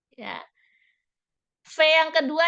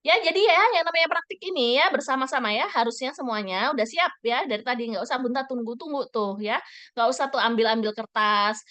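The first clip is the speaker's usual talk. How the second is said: talking fast. Shown as a demonstration. Teacher demonstrates to parents how rushed talk sounds: fast, hurried. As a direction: rattle it off quickly and impatiently. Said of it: "Coba misalnya kalau Bunta ngomong kayak gini".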